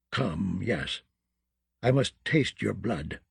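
put-on low, soft muttering old crone; terrible deep voice. A narrator's reading. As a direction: mutter like an ancient witch. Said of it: "her terrible, deep voice".

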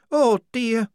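put-on high, loud worried girl's voice; anxious young woman. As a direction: fret in a high voice. Shufti voiced.

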